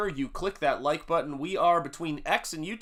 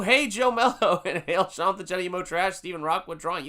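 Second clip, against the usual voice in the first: normal talking voice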